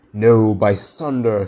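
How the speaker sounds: loud, slow, emphatic refusal; crying out. A narrator's reading. Pirate defying his captain, crying out loudly. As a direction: refuse loudly, drawing out the oath. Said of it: "Starkey cried".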